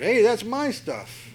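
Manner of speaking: high, slow mock yell; indignant shout. Micah chasing the thieves, indignant high yell. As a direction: call after the thieves, indignant.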